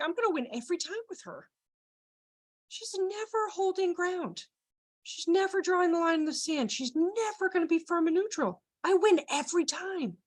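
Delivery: put-on confident kid. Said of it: "in my head, I think".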